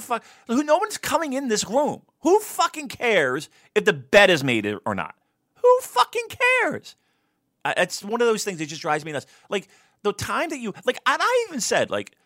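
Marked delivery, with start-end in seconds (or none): 5.6-7.0 high, loud, slow exasperated cry; exasperated outburst. Real, not acted. Ranting to his co-host about making the bed, a high exasperated wail. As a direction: throw up your hands and wail it.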